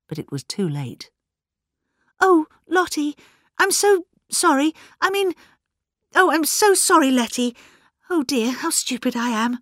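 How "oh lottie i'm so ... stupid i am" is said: high-pitched, flustered self-reproach; flustered apology. A narrator's reading. Flustered woman apologises for blurting secret.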